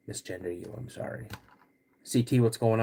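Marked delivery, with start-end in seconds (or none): 0.1-1.3 "I'm sorry" whispered under the breath; a sheepish, whispered sorry; whispered apology. Real, not acted. Apologizing for misgendering a viewer.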